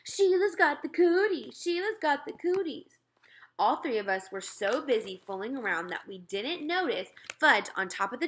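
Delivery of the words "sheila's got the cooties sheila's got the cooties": high schoolyard taunt, loud at the start; hollered taunt. Acted. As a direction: boys chanting to tease her. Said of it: "We hollered really loud".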